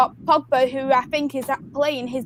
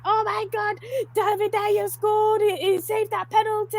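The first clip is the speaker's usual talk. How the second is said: retold excited scream; 8 semitones higher than usual. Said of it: "just screaming".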